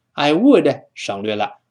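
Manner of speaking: ordinary delivery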